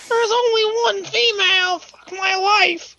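very high, crying mock whine; whining fan. Mocking a fan who cries over one female ranger, high whine. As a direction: wail it like a sobbing fanboy.

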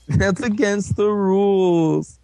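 high, slow mock whine; whiny complaint. Mocking the sore loser to a friend, whiny complaint. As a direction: stretch it out like a petty tattletale.

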